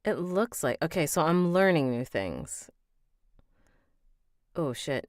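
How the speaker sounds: plain reading voice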